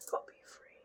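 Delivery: soft unvoiced whisper; whispered go be free. Vlogger whispering a little dare to himself about his outfit. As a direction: breathe it out like a secret.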